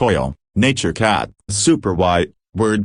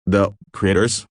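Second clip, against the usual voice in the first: ordinary delivery, nothing marked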